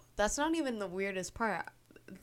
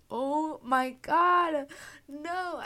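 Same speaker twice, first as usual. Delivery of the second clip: higher, slow horrified cry; mock horror; 8 semitones higher, 5 dB louder, much slower than usual. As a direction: gasp out in dismay. Watching [name] get beaten.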